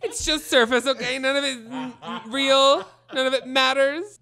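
high, dramatic mock lament. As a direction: ham up a jaded diva.

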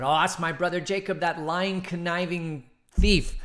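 rising, loud scorn at the brother; furious brother denouncing the thief; angry accusation. Acted. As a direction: spit out the insult. Esau angrily names his brother.

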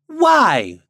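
loud, high indignant blue jay; angry demand. A narrator's reading. Blue jay to Popopo, demanding angrily. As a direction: throw it out as a sharp challenge. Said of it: "demanded a blue jay angrily".

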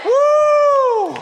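high ringing whoop of joy; joyful whoop. Real, not acted. Preacher whooping over a freed woman, loud and high.

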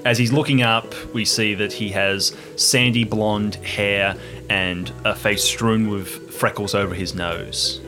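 usual speaking voice

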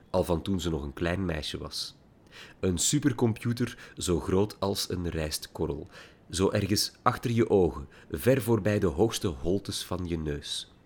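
ordinary delivery, nothing marked

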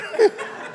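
short breathy mocking laugh; a quick scoffing laugh; unvoiced scoffing chuckle. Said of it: "starts mocking me".

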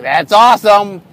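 loud, drawn-out burst of enthusiasm; enthusiastic reaction. Retold or imitated. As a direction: react like a delighted team, slow and loud. Team reacting to exciting description.

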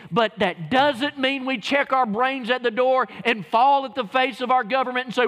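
loud, fired-up sermon; preaching at full force. Real, not acted. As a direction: preach it hard to the congregation.

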